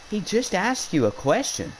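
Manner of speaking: high-pitched imitation of a friend's protest. Friend defending a student to the teacher, protesting. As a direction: argue it up high, without extra volume.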